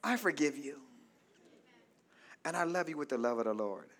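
low, soft, gentle reassurance; calm forgiveness. Shown as a demonstration. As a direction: show how to forgive someone calmly and quietly. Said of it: "just calmly say".

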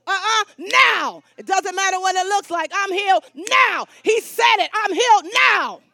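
loud preaching shout, hammering on now; defiant faith, shouted out; mad, insistent declaration. Shown as a demonstration. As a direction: get mad and just say it. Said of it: "you gotta get mad and just say it".

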